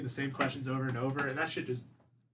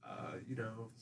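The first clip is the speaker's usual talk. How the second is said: barely audible, lower dodge; mumbled evasive reply; 6 semitones lower, 16 dB quieter, slower than usual. Retold or imitated. Dodging mom's question about friends, barely audible. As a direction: trail off to nothing. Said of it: "I'm like".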